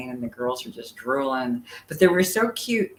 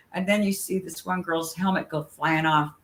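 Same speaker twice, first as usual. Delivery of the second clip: normal talking voice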